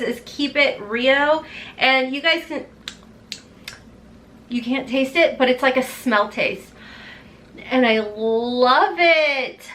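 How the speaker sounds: normal talking voice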